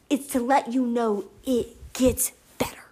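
an unvoiced, soft promise; whisper. Real, not acted. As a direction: whisper it tenderly. Tender whispered reassurance.

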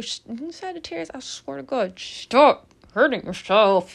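half-joking scolding; exasperated plea. Real, not acted. To the crying Sagittarian, exasperated scold. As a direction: tell her to stop, exasperated. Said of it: "It's gonna make me angry".

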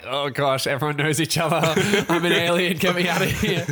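mock-panicked outsider, giggling through it; laughing panic. Retelling feeling out of place in the pub, laughing in mock panic. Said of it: "I'm like".

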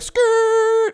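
high squealing tire noise; skid stop. Retold or imitated. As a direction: screech it like tires on pavement. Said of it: "It was like".

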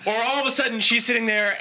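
ordinary talk, nothing marked

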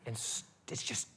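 breathy whispered aside, voice dropped to nothing. Acted. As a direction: lean in and whisper it like a confession. Intimate aside in sermon.

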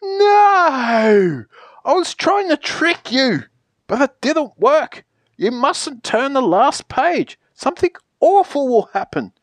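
long, high wail of "No", then frantic warning; high-pitched theatrical alarm; panicked protest. Acted. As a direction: cry out in horror as the trick fails. Panic as the trick fails.

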